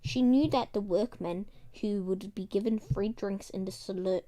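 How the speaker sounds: ordinary delivery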